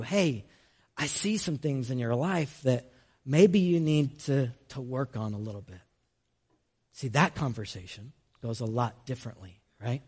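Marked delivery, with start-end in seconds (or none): 0.0-5.8 much softer, lower gentle caring; a trusted friend gently pointing out faults. Retold or imitated. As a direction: softly tell a friend what to work on. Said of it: "A dear friend ... has a similar conversation".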